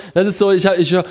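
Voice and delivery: ordinary talk, nothing marked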